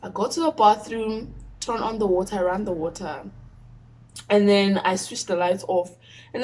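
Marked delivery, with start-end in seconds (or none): none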